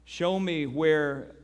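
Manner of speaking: hushed earnest prayer; quiet prayer. Acted. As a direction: pray it slowly and humbly. Leads prayer.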